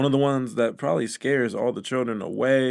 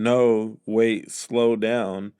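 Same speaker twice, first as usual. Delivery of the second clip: slowly quoting her; much slower than usual. Retold or imitated. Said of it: "what her saying".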